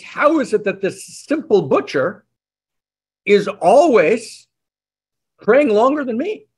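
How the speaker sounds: high, bewildered and indignant, rising to a near squeak; a rabbi who truly can't fathom it; exasperated rabbi. Acted.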